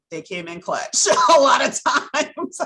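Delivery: loud, high, breaking into laughter; laughing. Real, not acted. Mother praising friends who came through, laughing.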